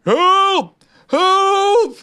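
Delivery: high, loud cry for help; calling for help. A narrator's reading. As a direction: call out high to someone below. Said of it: "it called".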